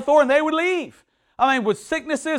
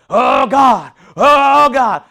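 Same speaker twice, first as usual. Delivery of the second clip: loud, higher, hammed-up cry to God; mock loud wailing prayer; 4 semitones higher, 9 dB louder, much slower than usual. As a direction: wail it like an over-the-top prayer. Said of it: "get ugly with the".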